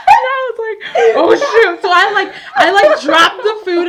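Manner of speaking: loud, high, laughing through the words; laughing while telling. Real, not acted. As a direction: tell it cracking up with laughter. Laughing through panicked story.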